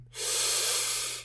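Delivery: long, slow audible breath; deep calming breath. Shown as a demonstration. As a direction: demonstrate a deep breath to calm down. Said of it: "breathe deeply and close your mouth".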